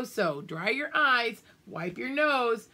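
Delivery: ordinary delivery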